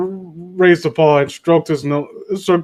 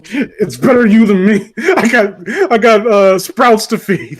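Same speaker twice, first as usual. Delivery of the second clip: higher-pitched mock desperation; self-saving tree; 5 semitones higher, 4 dB louder, slower than usual. Tree to betrayed friend, mock desperate excuse.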